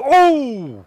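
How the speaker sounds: high sharp gasp of pain, acted; sudden gasp. Preacher imitating wife's sudden labor pain gasp. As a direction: cry out as a cramp hits. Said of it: "she goes".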